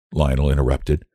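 ordinary delivery

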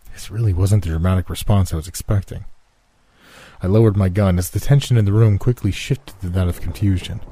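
ordinary delivery

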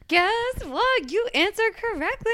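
high, bright game-show reveal; excited. Pretend. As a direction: announce the win like a host. Excited quiz host reveal.

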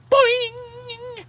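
high, held cartoon spring noise; boing like a spring, high and ringing; comic "boing" sound effect. Shown as a demonstration. Imitated spring noise after a joke.

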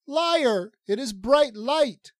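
very high, loud indignant contradiction; high-voiced Lala, accusing. Lala contradicts Rin about night, high and indignant. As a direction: cry out the objection in a high voice.

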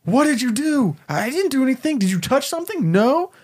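high frantic back-and-forth; quick panicked questions; panicked high voices. Acted. Time travelers quizzing the kid, high and frantic.

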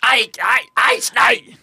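high, yelled false starts; comic high-pitched yelping; mock yelling. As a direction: yell the German-accent attempt. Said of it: "all I wanna do is yell".

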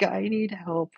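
usual speaking voice

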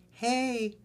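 high put-on girly voice; girl voice.

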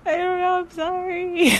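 high, strained, near-crying voice; teary apology. Retold or imitated. Retelling crying to husband, high teary apology. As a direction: squeak out a tearful sorry. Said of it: "I'm like".

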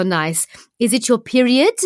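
hammed-up French accent with rising question; bad French accent. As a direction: ask it as a French lover. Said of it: "I can do a French accent".

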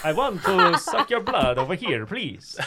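hammed-up Transylvanian transatlantic vampire; slow, high, laughing Transylvanian transatlantic drawl; Transylvanian transatlantic accent. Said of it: "it would sound a little something like this".

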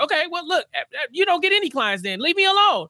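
high, exasperated brush-off; mock exasperated Harvey. Harvey brushing Lewis off, high and exasperated. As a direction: shoo him off in a high mock voice. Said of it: "Harvey's like".

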